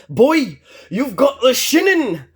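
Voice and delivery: put-on hammy Scottish accent, high and loud; bad Scottish accent, shouted up high; Groundskeeper Willie. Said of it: "terrible impersonation of Groundskeeper Willie".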